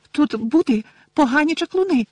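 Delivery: very high squeaky elf, flustered; Winky squeak. Acted. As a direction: squeak it out in a panic. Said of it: "розгублено пискнула вона".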